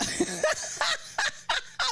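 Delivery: high burst of laughter; bursting out laughing; real laugh. Real, not acted. Host laughing at the Titanic joke, high burst.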